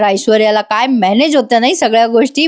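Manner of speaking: normal talking voice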